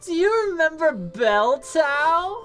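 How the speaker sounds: high, delighted shrieking recognition; excited squeal. Acted. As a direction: squeal with joy at an old friend. Nymph recognizes Beltau, squeals.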